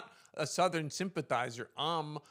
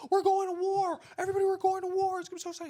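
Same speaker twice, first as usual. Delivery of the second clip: very high, loud and giddy mock soldier boys; hammed-up boys cheering about going to war; eager young soldiers; 5 dB louder, faster than usual. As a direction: squeal it like thrilled boys off to war.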